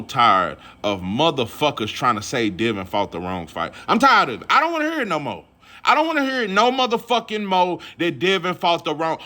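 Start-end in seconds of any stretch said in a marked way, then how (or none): 3.9-9.2 fed-up outburst. Real, not acted. Fed-up rant about excuses.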